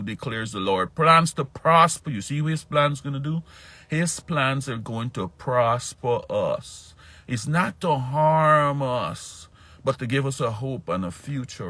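high, loud, slow sermon delivery; preaching. Acted. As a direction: proclaim the promise to the congregation. Preaching the promise.

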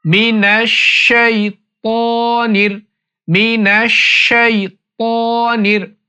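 মিনাশ শাইতানির with a heavy তা, tongue tip on upper teeth; careful slow মিনাশ শাইতানির; correct tajweed demo. Shown as a demonstration. Teacher showing a heavy ত in শাইতানির. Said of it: "আমি যেভাবে উচ্চারণ করছি".

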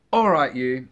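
loud scolding mother bird; loud, hammed-up angry mum; screaming mother pigeon. A narrator's reading. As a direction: yell a warning at your chick. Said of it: "screamed the mother pigeon".

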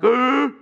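put-on loud kids' freak-out noise; short, loud, acted outburst; mock freak-out gasp. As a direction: imitate kids freaking out without words. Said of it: "They were freaking out".

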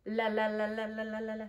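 "La" in a quick even string; letter sound. Shown as a demonstration. Teacher showing L's sound, repeated even "la". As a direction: repeat "la" on one note, tailing off. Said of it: "What sound does L make".

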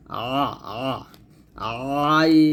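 আ and আইন squeezed from the throat; pressed ain. Shown as a demonstration. Teacher demonstrating a pressed throat আইন. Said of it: "আইনটা চাপ দিয়ে বলতে হবে".

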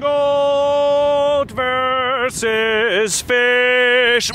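slow, drawn-out grand announcement; announcer intro. Acted. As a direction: intone it like an epic title. Podcast host intoning show title.

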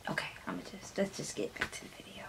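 hushed, quick whisper. Real, not acted. Embarrassed after gushing, whispering to move on. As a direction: mumble it under your breath.

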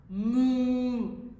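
loud lowing moo; cow moo. Shown as a demonstration. Cow imitation.

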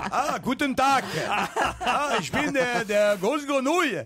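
slow laughing mock German; German accent. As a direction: ham up the German. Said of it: "vous la connaissez en allemand".

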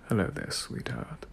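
soft, low British gentleman greeting; a quietly dangerous, sultry British hello. Shown as a demonstration. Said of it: "you get into some very dangerous territory".

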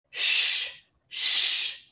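"Sh" as a long hushed sh, mouth forward; sh sound. Shown as a demonstration. Teacher drills the sh through the teeth. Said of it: "a sua boca pra frente".

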